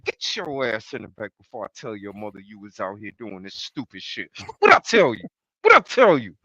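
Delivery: put-on low, hushed scolding like an older cousin; big cousin scolding. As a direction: mutter the threat, then snap the question. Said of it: "like a big cousin".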